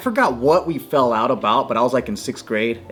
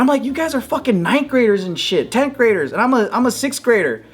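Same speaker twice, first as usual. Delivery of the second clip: much higher, pleading complaint; high-pitched protest; 9 semitones higher than usual. Retold or imitated. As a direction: whine that it isn't fair, pitched up. Kid protesting unfair fight.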